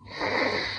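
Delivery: long voiceless hiss; soft sinister sss; Gollum hiss. A narrator's reading. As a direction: hiss through the teeth like a creature. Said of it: "said Gollum".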